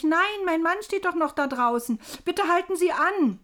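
loud, high desperate plea; verzweifeltes Rufen. A narrator's reading. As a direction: shout for the train to stop. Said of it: "rief ich". (laut und flehend rufen)

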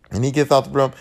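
ordinary talk, nothing marked

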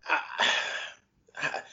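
choked up, halting between words; fighting back tears, barely getting it out; voice catching with grief. Real, not acted. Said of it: "I'm getting choked up".

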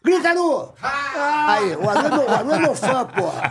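high-pitched, gleeful boasting; hyped-up high voice with laughter round it; excited crowing. Pretend. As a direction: yell it in playful triumph. Goads and claims Aru as fan.

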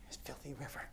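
whispered, disgusted grumble. Acted. Naaman grumbling to himself in the filthy river, whispered. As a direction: hiss it under your breath like a mutter of disgust.

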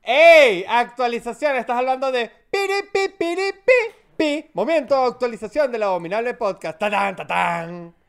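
sung-out beeping jingle voice; promo announcer. Pretend. Launching the podcast's update segment, announcer with vocal jingle. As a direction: announce it like a radio segment sting.

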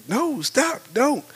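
high-pitched imitated plea; urgent warning. Partner trying to tell him to stop, imitated warning. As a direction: plead to halt, pitched high.

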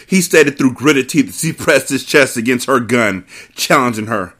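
usual speaking voice

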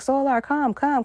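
high, thin, pleading voice calling a name. Retold or imitated. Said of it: "She's like".

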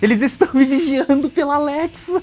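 mock panic. Mock paranoia about Alexa spying.

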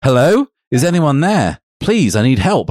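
high-pitched calling out; hammed-up plea for help; mock shout for help. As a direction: call out theatrically, not at full volume. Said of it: "I shouted".

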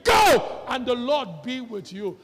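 shouted blessing. Acted. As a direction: cry it out to send him off. Saul sends David.

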